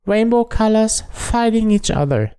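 put-on high squeaky girly voice; girlfriend impression. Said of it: "that's exactly what my girlfriend said".

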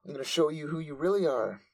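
high-pitched bratty snap; high angry anime girl. Retold or imitated. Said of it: "Nymph is like".

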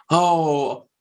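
put-on innocent, matter-of-fact kid excuse; matter-of-fact child. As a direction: imitate a small boy casually explaining why he's late. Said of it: "in such a matter-of-fact way".

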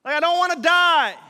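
loud, high, desperate yell. Retold or imitated. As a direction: scream at him, terrified. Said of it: "They're literally yelling at Jesus".

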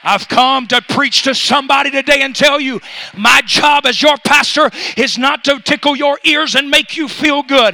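loud, rising pulpit cry; preaching at full force. Acted. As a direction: preach it out to the back row.

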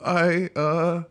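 put-on hesitant, nervous stammer of a kid owning up; mock-nervous kid confessing to a parent; timid creeping kid. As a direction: creep in with a guilty, halting start. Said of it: "like, creeping".

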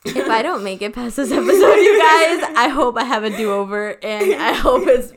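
very loud, laughing declaration; loud mock-desperate appeal through laughter; mortified laughing plea. Real, not acted. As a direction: belt it out while cracking up with embarrassment. Embarrassed, joking through laughter.

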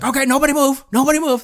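high, urgent impression of the actor; hammed-up celebrity imitation; Morgan Freeman. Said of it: "kind of a better Morgan Freeman".

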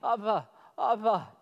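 lightly voiced toddler babbling Abba; toddler's first word. Shown as a demonstration. Pastor imitating a toothless child's first word, lightly voiced. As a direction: say Abba like a baby's first sound. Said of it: "a soft palate of a child without teeth".

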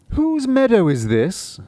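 high, loud, slow questioning cat; a high, slow cat asking who owns the land; cat's voice. Acted. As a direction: lift the voice into the curious cat.

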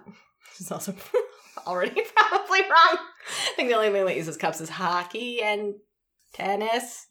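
ordinary talk

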